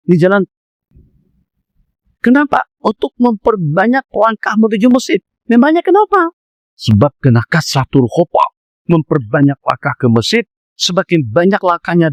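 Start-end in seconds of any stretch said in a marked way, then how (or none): 5.5-6.3 high sharp question; rhetorical question. Acted. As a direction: throw it to the crowd. Rhetorical challenge to audience.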